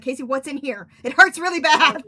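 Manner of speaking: playing up the pain for Casey; mock pained complaint. Telling Casey about her side pain in a hammed-up whine. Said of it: "And I was like".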